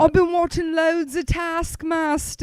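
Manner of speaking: high, loud mock London accent; British accent. Shown as a demonstration. Host demands approval in a loud, high put-on British accent. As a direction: challenge them in exaggerated British. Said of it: "I got a good British accent".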